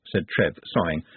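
ordinary delivery, nothing marked

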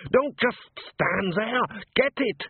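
high, strained, drawn-out command; panicked order. A narrator's reading. Butcher to his workers facing the golem, panicked order.

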